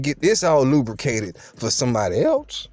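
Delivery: plain reading voice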